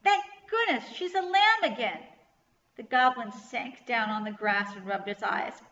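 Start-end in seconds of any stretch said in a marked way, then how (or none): 0.0-2.1 high, loud, relieved cheer. A narrator's reading. Kirsty hugging Rachel over the lamb, cheering. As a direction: gush with relief, voice raised. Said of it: "Kirsty cheered".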